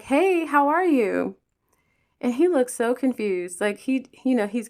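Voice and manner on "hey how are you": friendly greeting. Retold or imitated. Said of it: "I wave at him and I'm like".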